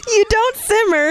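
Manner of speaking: high, giggly reading, laughter still in the voice; laughing reread. Real, not acted. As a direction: read it again while still cracking up. Rereading the line, cracking up.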